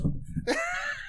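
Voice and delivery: high squealing yelp, far above his voice; hammed-up high-pitched taunt shriek; mock taunt yell. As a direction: throw out a shrill wrestler taunt cry. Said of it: "And he goes".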